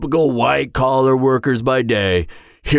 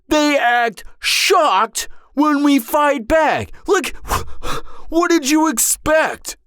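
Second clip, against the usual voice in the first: climbs from irritated to shrill, higher and higher; building furious rant; 10 semitones higher than usual. Acted. Villain on a date ranting about rival heroes, building outrage. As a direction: let the indignation spiral up until it cracks.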